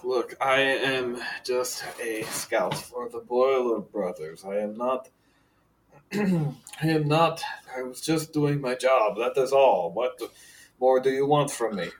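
slow, pressed plea from a captive; a scout insisting he only did his job; pleading prisoner. Acted. Prisoner pleads with the priest, slow and strained.

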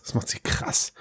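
breathy whisper. Acted. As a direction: whisper it to yourself. Repeats tagline unvoiced.

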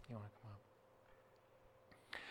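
slow, breathless whisper off-mic; whispered aside. Real, not acted. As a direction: quietly call someone up. Aside to someone mid-talk.

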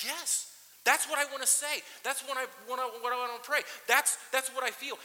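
high, loud, eager yes that's it; excited recognition. Retold or imitated. Pastor retelling reading the Psalms in a hard year, excited loud relief. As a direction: burst out in relief at finding the words.

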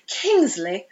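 lightly imitated British accent. Co-host quoting Doyle saying his son's name, light British accent. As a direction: say it with a touch of British. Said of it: "he would say it in a British accent".

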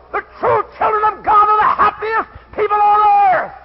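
high, slow, drawn-out revival shout; full-force preaching. Real, not acted. Preacher praising God over worldly amusements, full-force shouted preaching. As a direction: cry it out at full voice to the congregation.

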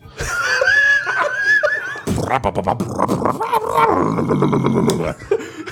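big shrieking laugh. Real, not acted. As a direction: howl with laughter. Bursts out laughing after the bit.

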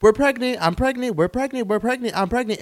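high, squealing mock excitement; mocking family vloggers. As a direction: parody gushing parents announcing. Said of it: "people saying".

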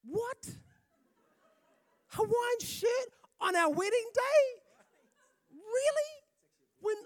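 hammed-up bride appalled at the groom's shirt; outraged bride. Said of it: "she looks at him and goes".

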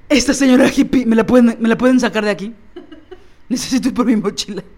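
put-on laughing through a whiny kid plea; giggling, pleading to be rescued. Kid voiced, laughing at mom.

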